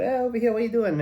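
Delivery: light acted call across a bar; calling him over. Bartender to a fumbling customer, calling him over.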